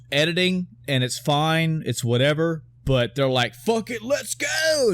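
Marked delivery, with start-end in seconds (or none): 3.5-4.9 high, drawn-out charge into a fight; mock battle cry. Movie heroes rushing into a fight, mock battle cry. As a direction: imitate a gung-ho cry, stretching the last word.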